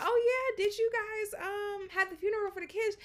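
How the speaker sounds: high-pitched acted asking; retold casual question. Asking a client about the funeral, high-pitched retold question. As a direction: raise the voice well up, as if chatting.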